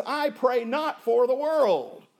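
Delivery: slow, ringing proclamation; loud preaching. Shown as a demonstration. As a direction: proclaim it slowly at full voice. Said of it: "the King of Kings and Lord of Lords, says".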